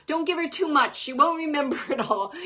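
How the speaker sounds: a husband firmly cutting the reading short; loud firm warning. Retold or imitated. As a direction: insist hard so she stops. Medium voices husband's firm caution.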